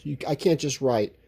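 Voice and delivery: ordinary talk, nothing marked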